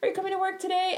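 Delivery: high-pitched questioning coworker voice; mimicked caller. Imitates a caller from work.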